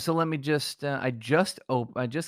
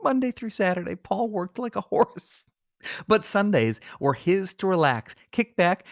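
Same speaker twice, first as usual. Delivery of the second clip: slow, higher, dramatic eulogy delivery; hammed-up gravitas; 7 semitones higher, slower than usual. Host milks the obituary for drama, slow and lofty. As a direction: stretch it out like a grand tribute. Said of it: "with gravitas and honor".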